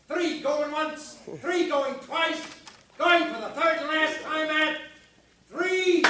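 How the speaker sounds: slow, building auctioneer's call. Acted. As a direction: drag it out, then punch the end. Auctioneer closing the sale.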